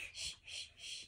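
soft unvoiced shushing; whispering over a crib. Real, not acted. Hushing a doll to sleep.

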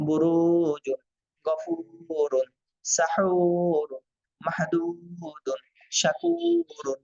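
বুরুজুন and শাকুরুন with each long u stretched slowly; slow, stretch every long vowel for students; drawn-out madd vowels. Shown as a demonstration. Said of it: "একটু টান হবে আর কি". (টেনে টেনে ধীরে উচ্চারণ)

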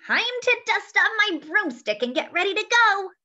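put-on high, loud cheerful old witch. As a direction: play the lively witch Maggie. The witch speaks in story.

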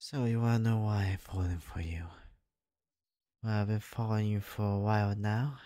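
usual speaking voice

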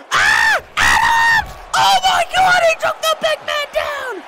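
high-pitched shocked scream; screamed play-by-play. Real, not acted. Commentator sees the big man taken down, screaming. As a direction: scream it as the giant falls.

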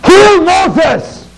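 high, shouted command to kill; king's order. Acted. King shouting his order to have Moses killed. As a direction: bark it like a furious king. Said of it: "So the king said to me".

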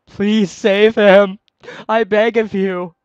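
loud, high, drawn-out begging; desperate pleading. Acted. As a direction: plead at full volume, stretching the words slowly. Acted plea read from game text.

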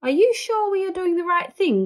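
high, anxious gasped question. Acted. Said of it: "gasped Mis-, M-, Mister Gruber".

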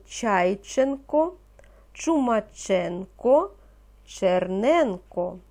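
Чайченко, Чумаченко, Черненко slowly, each stress clearly marked; careful stress. Shown as a demonstration. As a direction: spell out the stress in each surname, slow. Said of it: "Правильно ставте наголос". (повільно, з чітким наголосом)

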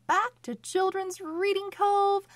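high, loud, fast bright greeting; excited welcome. Acted. Host welcoming children to the reading channel, excited. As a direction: greet the kids with big energy.

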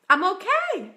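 brightly retold reassurance to oneself; retold relief. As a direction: land it as a sudden happy realization. Retells her moment of relieved realization.

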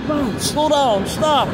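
high, urgent plea at the train; high-pitched excited urging; excited pleading. Real, not acted. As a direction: beg the train to stop, voice jumping up. Urging passing train to slow.

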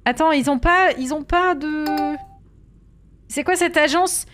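nearly losing her temper at the agency; getting worked up. Real, not acted. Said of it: "J'ai failli m'énerver".

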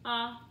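a as "ah", as in octopus; short vowel sound. Shown as a demonstration. Teacher drilling short vowels, a as "ah". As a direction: say a as a short open "ah". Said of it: "O, octopus".

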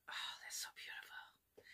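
breathy, hushed delight; admiring whisper. Real, not acted. Admiring the Poppin' swatch to viewers, awed whisper. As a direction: whisper it in awe.

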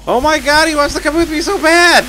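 gleeful high-pitched shout; excited shriek. Real, not acted. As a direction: squeal it out in disbelief. Creature in game follows him.

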